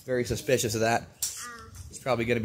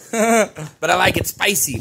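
said with a laugh, louder and higher; 6 semitones higher, 5 dB louder than usual. Real, not acted. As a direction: laugh through the catchphrase. Punchline about his last name.

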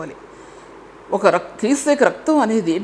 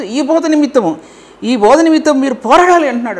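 higher and louder, driving each phrase home; fiery preaching; 5 semitones higher, 3 dB louder than usual. Acted. Preacher urging the church to hold the teaching, fiery and loud. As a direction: preach it hard to the congregation. Preacher rises to full exhortation.

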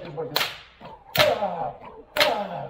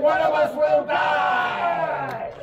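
dramatic announcement; 7 semitones higher, much slower than usual. Acted. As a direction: announce it like a showman.

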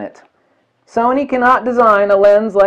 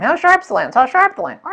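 much higher, nagging repeat; whiny mock question; 11 semitones higher than usual. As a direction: mimic the nagging crowd, pitch climbing. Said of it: "that's the thing I hear all the time".

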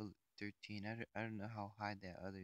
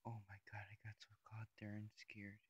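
softer relieved whisper; 6 dB quieter than usual. Real, not acted. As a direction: let the fear out under your breath. Relief after close call.

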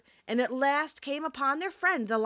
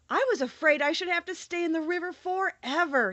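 lightly voiced relieved Scarecrow, higher; a hint of a glad straw man, pitched up; 7 semitones higher than usual. A narrator's reading.

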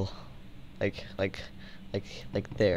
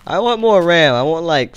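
whiny; 7 semitones higher, 10 dB louder than usual. Pretend. Whiny demand to viewers.

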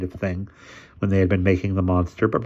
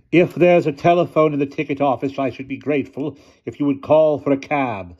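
put-on old lady voice; 7 semitones higher, 5 dB louder than usual. A narrator's reading. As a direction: speak like a commanding elderly aunt.

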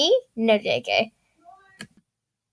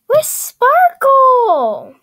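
dismayed wail; 11 semitones higher, 7 dB louder, much slower than usual. Real, not acted. Pet missing from the shop.

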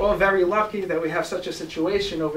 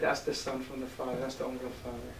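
much softer, low, grave rabbi; hushed verdict; 8 semitones lower, 13 dB quieter than usual. Acted. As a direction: say it almost under the breath.

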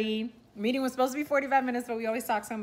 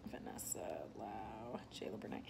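whispering names while jotting them down; whispered to herself; 12 semitones lower, 17 dB quieter than usual. Real, not acted. Writing notes, whispers names to herself softly. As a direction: breathe the names under your breath.

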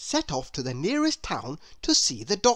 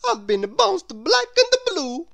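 sorry for himself; 13 semitones higher, 6 dB louder than usual. Acted. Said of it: "feeling very sorry for himself".

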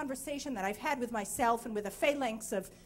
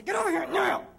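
higher, louder angry yell; mother losing it with her two-year-old, angry shout; 6 semitones higher, 4 dB louder, faster than usual. Retold or imitated. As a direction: patience gone, shout it. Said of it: "And then I get angry".

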